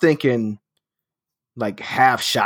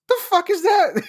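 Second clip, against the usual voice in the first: much higher, incredulous outburst; shocked by how good it tastes; stunned disbelief. Retold or imitated. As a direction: pitch shoots way up in disbelief.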